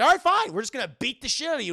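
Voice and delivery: high, loud snapped threat; mock threat. Brad threatening Max after the rejection. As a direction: lose your cool and threaten.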